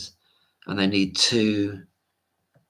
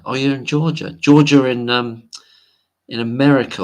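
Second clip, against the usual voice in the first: surprised; 5 semitones higher, 9 dB louder than usual. Real, not acted. Surprised reading viewer's location.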